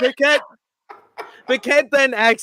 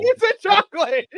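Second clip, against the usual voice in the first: mock grandma coo; 13 semitones higher, 5 dB louder than usual. Echoing the chocolate line.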